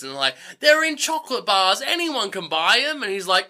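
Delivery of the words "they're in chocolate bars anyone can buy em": high-pitched lightly voiced kid; eager kids. Kids telling teacher where tickets are, lightly eager. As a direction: pipe up with the answer.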